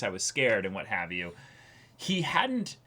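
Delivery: ordinary talk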